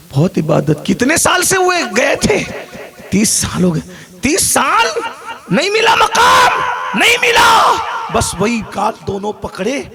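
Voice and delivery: high, loud, impassioned preaching; preaching at full force. Acted. Preacher tells the congregation of the mother's reunion, impassioned high shouting.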